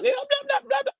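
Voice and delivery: put-on high, soft babbling "blah, blah" like a toddler; baby babble. Grandma imitating her baby grandson talking back, high and soft. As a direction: lightly mimic a one-year-old's gibberish. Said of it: "He can't even talk".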